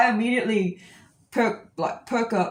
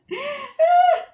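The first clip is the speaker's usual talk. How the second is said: very high, loud laughing squeal; happy squeal; 6 dB louder than usual. Retold or imitated. Overjoyed at not being the only one, squealing. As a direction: shriek with delighted laughter.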